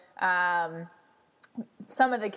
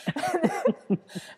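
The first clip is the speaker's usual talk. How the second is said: laugh; 9 semitones higher than usual. Real, not acted. Laughing after the retold quote.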